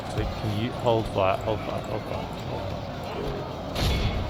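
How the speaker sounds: hushed hold fire. Real, not acted. Commander hushing his archers to hold fire, near whisper. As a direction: breathe the order so nobody fires.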